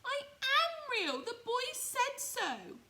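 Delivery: very high, loud and slow, hurt rabbit; squeaky loud slow tearful little rabbit; nearly crying. Acted. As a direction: plead it high and slow, close to tears. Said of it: "he nearly began to cry".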